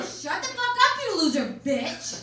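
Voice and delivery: furious mom yelling at her daughter. Retold or imitated. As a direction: blow up and scream it at her. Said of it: "I started yelling".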